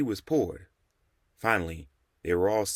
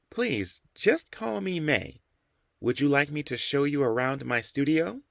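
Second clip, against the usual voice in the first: higher, slow, gentle hostess voice; gentle woman artist; 6 semitones higher than usual. A narrator's reading. Mei invites the men to use her first name, gentle. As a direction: put guests at ease.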